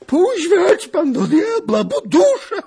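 high, loud, stretched cry; old beggar hollering while being shaken; full-voiced exasperated yell; yelled protest. A narrator's reading. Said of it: "odkrzyknął dziad".

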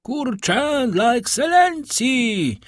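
high, drawn-out shouted order; full-force bellow. A narrator's reading. Guard calls the chicken order to the kitchen, bellowing. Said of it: "huknął z całych sił".

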